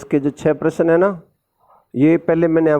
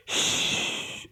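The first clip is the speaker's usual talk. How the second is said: soft unvoiced shh with finger to lips; quiet shh sound; shushing; 12 dB quieter, much slower than usual. Shown as a demonstration. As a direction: hush someone with a long shh. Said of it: "ऐसे मुंह पे उंगली रख के".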